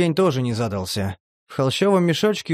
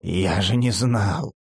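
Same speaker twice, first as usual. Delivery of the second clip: lower, slow, dismayed; Demid's dismay; 6 semitones lower, slower than usual. A narrator's reading. Demid pleads ignorance to Vena, lower and slow. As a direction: protest you didn't know.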